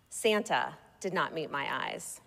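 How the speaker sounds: ordinary delivery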